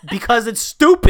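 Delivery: loud, high, laughing protest; laughing retort. Real, not acted. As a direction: fire back through a laugh. Laughing retort in mock quarrel.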